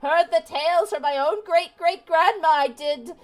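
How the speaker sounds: high, wailing doom-crying granny; old lady voice. Acted. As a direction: wail of doom like an old crone. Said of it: "my old lady voice".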